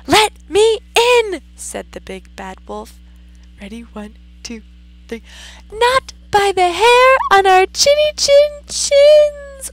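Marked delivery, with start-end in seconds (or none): 0.1-1.4 high, loud demand; wolf demand. Acted. Wolf at the brick house, loud high demand. 5.7-9.7 very high, loud piggy cry. Acted. Pigs shouting at the wolf, high and loud. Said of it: "cried the pigs".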